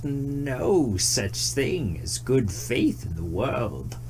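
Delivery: very slow, grave answer; slow tree voice. A narrator's reading. Tree giving its verdict to the deer, very slow and grave. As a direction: draw it out heavily, like an old tree. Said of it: "said slowly".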